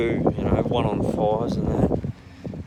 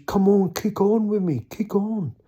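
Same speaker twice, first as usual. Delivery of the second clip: retold urging; 10 semitones higher, 3 dB louder than usual. Mate urges tired group to party.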